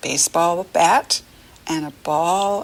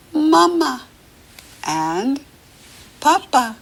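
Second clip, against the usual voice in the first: higher, louder doll-like Mama and Papa; talking doll voice; 8 semitones higher, 4 dB louder than usual. Acted. Teacher voices a talking doll for the kids, doll voice. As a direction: squeak it like a toy doll. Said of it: "this little doll says".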